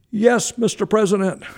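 very high, loud, eager reply; imitated over-excited coach on the phone; high-pitched, excitable Woody Hayes. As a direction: squeal it out to the president. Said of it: "high-pitched, excitable voice".

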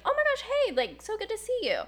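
high, excited friendly greeting; a lightly voiced, high and bubbly surfer dude; excited surfer guy. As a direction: play the surfer guy greeting her eagerly. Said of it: "and he's like".

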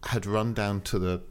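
ordinary delivery

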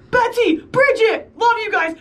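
high, slow friendly call across the street; cop calling out. Retold or imitated. Cop on a golf cart calls out to the hosts, high and drawn out. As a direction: sing out their names like an excited fan. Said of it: "a cop is pulling by and says".

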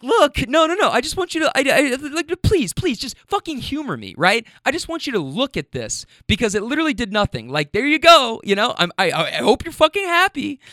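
high, pleading with rising frustration; exasperated pleading. Retold or imitated. To the lady holding up the bag, exasperated pleading. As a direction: beg her to just look, fed up. Begging her to look at bag.

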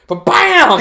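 high, loud explosive hit; imitated sudden thump. Doctor reaching the sore spot on the patient, explosive imitated thump. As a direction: burst out with one big impact sound. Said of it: "and then it was like".

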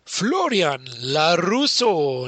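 very slow, drawn-out ring introduction; boxing ring announcer. Pretend. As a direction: call out the name like a fight announcer. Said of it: "in der blauen Ecke".

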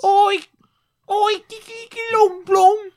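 loud, very high mock old English yelling; comic high-pitched English squawk; olde English accent. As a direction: shriek it like a cartoon old-time Englishman. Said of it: "whatever English people sounded like in the sixteen hundreds".

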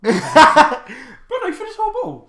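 high-pitched laugh through the words; cracking up mid-sentence. Real, not acted. Bursting out laughing at friend.

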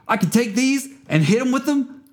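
high-pitched comic cop impression; Officer Stanford. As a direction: push the voice way up as an excitable officer. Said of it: "That's my impression of Officer Stanford".

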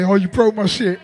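ordinary talk, nothing marked

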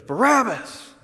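high, loud yell of the name; businessman yelling. Retold or imitated. Businessman in the crowd demanding Barabbas, high yell. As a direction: shout the name up over the crowd. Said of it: "he goes".

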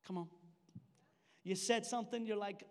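ordinary talk

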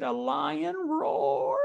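high, drawn-out child's roar, imitated; mock tearful roar. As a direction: stretch out a small lion roar as the upset boy. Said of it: "he's still crying".

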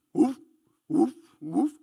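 imitating a hound's bark, loud; dog barking. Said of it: "And he would bark".

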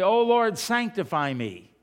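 fervent plea. Retold or imitated. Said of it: "whenever we say".

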